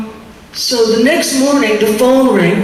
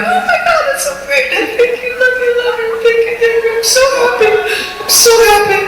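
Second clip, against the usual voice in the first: much higher, tearful overjoyed gush; imitating sobbing joy; 13 semitones higher, faster than usual. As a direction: retell her own crying thanks in a high tearful voice. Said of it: "and I'm sobbing".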